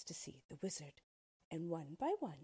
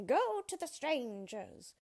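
higher, louder, slower witch command; crying witch; 8 semitones higher, 6 dB louder than usual. A narrator's reading. Wicked Witch sending her wolves, a high slow cry. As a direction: order the wolves out, high and drawn out. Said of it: "cried the witch".

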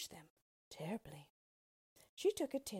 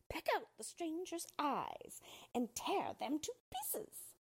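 much higher witch voice with a different timbre; commanding witch; 10 semitones higher than usual. A narrator's reading. Wicked Witch commanding her crows, high cruel command. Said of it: "she commanded them".

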